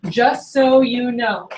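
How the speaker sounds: "just so you know" drawn out slowly; deliberate, slow "just so you know"; key phrase. Shown as a demonstration. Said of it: "this is a key phrase".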